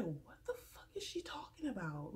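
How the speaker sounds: whispered, baffled. Retold or imitated. To viewers, recalling her confusion, whispered. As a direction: breathe it out in confusion. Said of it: "I'm just like".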